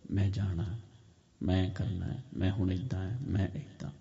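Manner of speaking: very hushed and low; quiet inner voice. Shown as a demonstration. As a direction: murmur it low, as if to yourself. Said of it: "ਹੌਲੀ ਹੌਲੀ ਗੱਲ ਕਰੋ".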